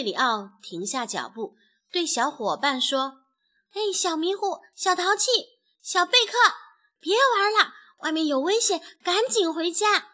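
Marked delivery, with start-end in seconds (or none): 3.7-10.1 high, loud little chick voice; urgent calling. A narrator's reading. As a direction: call playmates home quickly, name by name. Chicks call playmates home from danger. (尖声急切地招呼伙伴)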